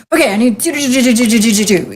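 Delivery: imitated rattle of endless requests; mock rapid-fire demands. Wife imitating her wide-awake husband's demands, mock rapid-fire. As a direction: rattle off the to-dos like a machine gun.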